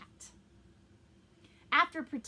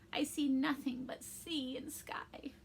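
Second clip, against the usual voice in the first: slow, weak, hopeless whisper; discouraged whisper; 3 semitones higher, slower than usual. Acted. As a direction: whisper it with no hope left. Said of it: "Geppetto sounds really discouraged".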